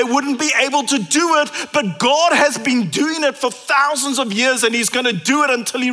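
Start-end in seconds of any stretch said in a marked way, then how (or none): none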